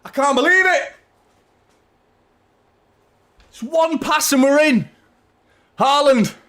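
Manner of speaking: loud and high, still breathless with joy; elated. Real, not acted. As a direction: still riding the high of the goal. After the goal, still buzzing.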